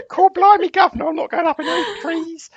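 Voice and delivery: loud, very high mock Cockney; hammed-up Cockney squawk; Eliza Doolittle. Co-host playing the monkey, exaggerated Cockney protest. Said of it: "I was a bit Eliza Doolittle there".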